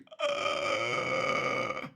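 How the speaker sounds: long low death rattle; last-noise imitation. Killer imitating the victim's last noise, low death rattle. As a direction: croak like someone's dying noise. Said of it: "the last noise she was making".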